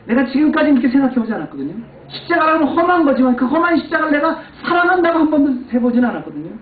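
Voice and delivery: high, loud, fast preaching; passionate preaching. Acted. Pastor confessing to the congregation, fast passionate preaching. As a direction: rush it out with fervor. Sermon climax on the cross.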